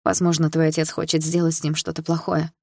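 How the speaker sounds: plain reading voice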